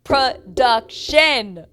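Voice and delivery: loud, each syllable stretched way out; big, slow, scolding-style emphasis on "Production"; drawn-out call-out. Retold or imitated. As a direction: hammer it syllable by syllable, very slowly. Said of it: "I said".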